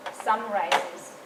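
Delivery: normal talking voice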